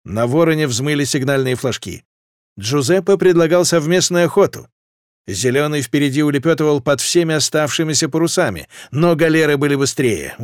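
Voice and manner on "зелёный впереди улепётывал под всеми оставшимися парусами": usual speaking voice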